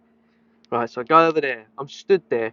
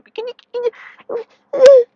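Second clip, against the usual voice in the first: mock pleading dog owner; 9 dB louder than usual. Dog owner begging staff to let the dog fly, whiny. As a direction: whine it like a desperate pet owner.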